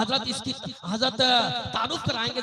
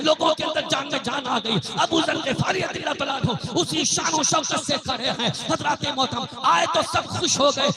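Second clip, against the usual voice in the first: fervent sermon storytelling; impassioned preaching; 4 semitones higher, 4 dB louder than usual. Acted. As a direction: preach the tale with rising conviction. Preaches a story of a kept promise.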